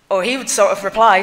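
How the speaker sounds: ordinary delivery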